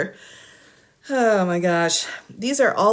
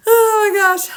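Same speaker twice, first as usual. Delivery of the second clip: much higher, louder, cry-laugh; laughing wail; 13 semitones higher, 9 dB louder than usual. Real, not acted. Commentator overwhelmed by the motions, laughing wail. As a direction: squeal with disbelief.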